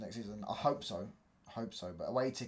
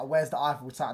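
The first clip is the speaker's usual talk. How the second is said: a hint of a foreign tourist accent, "Tower" as "Sower"; mock tourist accent; 3 semitones higher, 7 dB louder than usual. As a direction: ask it like a lost foreign tourist. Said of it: "different accent and be like".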